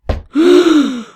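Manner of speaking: sharp high startled gasp; loud gasp. Acted. Woman startled by the prank, loud gasp. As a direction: gasp in shock at the floating book.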